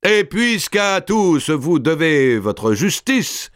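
high, ringing voice of a proud giant lord; booming Robert. A narrator's reading. Robert addresses the king before his vassals, high and proud.

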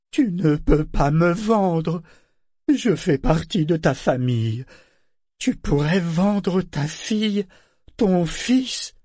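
high, slow, emphatic pleading; anguished protest. Acted. Ship protests to the relative who sold him, anguished. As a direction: protest with hurt disbelief, drawing it out.

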